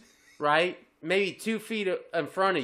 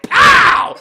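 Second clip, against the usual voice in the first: very high, very loud pow; hammed-up fight shout; mock battle cry; 17 dB louder than usual. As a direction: yell it like throwing a punch. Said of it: "And I'm like".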